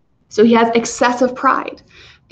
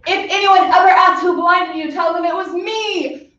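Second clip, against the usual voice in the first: boastful hero; 7 semitones higher than usual. Retold or imitated. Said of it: "Odysseus turned around like".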